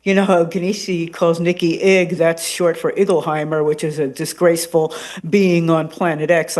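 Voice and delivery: ordinary talk, nothing marked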